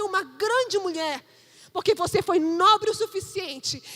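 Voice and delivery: building, emphatic sermon delivery; preaching. Acted. Pastor exhorting the parents to listen, building preaching. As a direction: urge the parents, rising to a peak.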